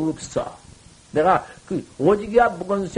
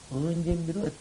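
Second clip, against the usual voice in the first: 언제 repeated softer, almost to himself; soft echo; 8 dB quieter than usual. Shown as a demonstration. Monk repeats his question to the assembly, much softer.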